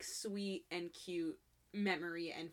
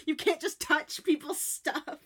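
laughing scold; 7 semitones higher, 7 dB louder, slower than usual. Real, not acted. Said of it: "I literally wrote down".

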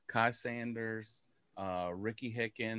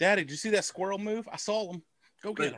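excited higher pet voice, a bit faster; pet's voice; 8 semitones higher, 5 dB louder, faster than usual. Pretend. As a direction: voice the dog eager about a squirrel. Voicing his pet at the window.

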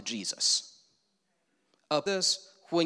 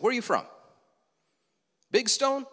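dad's curious question; 7 semitones higher, 4 dB louder than usual. Retold or imitated. Dad questioning a stranger.

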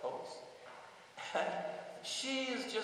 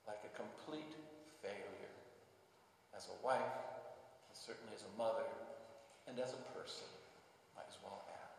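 sorrowful hush; 8 semitones lower, 14 dB quieter than usual. A narrator's reading. Hannah's deep despair told.

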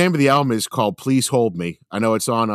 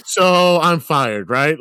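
mock exasperation; 5 semitones higher, 4 dB louder, much slower than usual. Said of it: "He's like".

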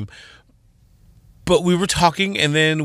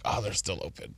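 quiet dismay; 6 semitones lower, 15 dB quieter than usual. Retold or imitated. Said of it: "you're like".

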